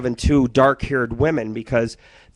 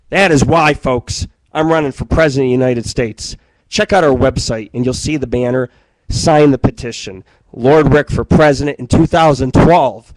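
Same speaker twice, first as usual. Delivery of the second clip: mock campaign pitch; 5 dB louder than usual. Campaign announcement speech.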